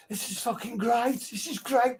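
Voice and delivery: high excited [name] voice; [name] impression. [name] hearing the mix, high excited rave. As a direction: gush over the mix like [name]. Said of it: "he was like".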